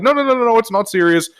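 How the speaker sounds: high-pitched backpedalling; retold hasty reassurance. Salesman reassuring an alarmed customer, high-pitched mock reassurance. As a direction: brush off the worry in a high voice.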